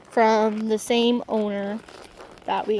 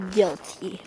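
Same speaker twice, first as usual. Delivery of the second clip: ordinary talk, nothing marked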